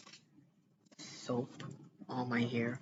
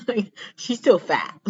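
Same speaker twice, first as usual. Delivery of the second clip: laughing; 8 semitones higher, 7 dB louder than usual. Real, not acted. Laughing at her own joke.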